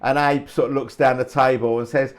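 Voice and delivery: ordinary talk, nothing marked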